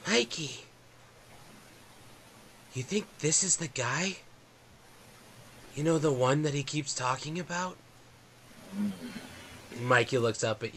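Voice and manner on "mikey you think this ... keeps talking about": put-on high, hushed child voice; whispering kid. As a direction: lean in and murmur like a scared kid. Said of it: "the second kid goes".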